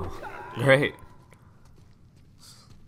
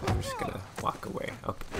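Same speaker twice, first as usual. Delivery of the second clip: high flustered; faster than usual. Real, not acted. Reacting to guard beating prisoner.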